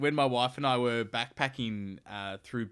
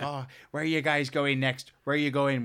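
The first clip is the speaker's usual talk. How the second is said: Belfast pub lady, a bit higher; imitated Belfast accent; 4 semitones higher, 5 dB louder than usual. Belfast lady asking travellers their plans, imitated Northern Irish accent.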